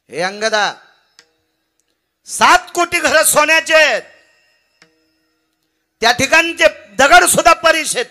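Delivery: high, full-voiced shout across a distance; calling from afar. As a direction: throw the words out loud and far. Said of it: "लांबून शब्द फेक केला".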